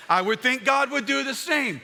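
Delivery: ordinary delivery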